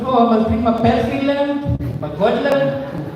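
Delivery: high, put-on voice gushing about the lamb; mock excited reply. Telling the crowd his own reply to the cop, in a high put-on voice. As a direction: ham up the eager answer. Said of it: "I said".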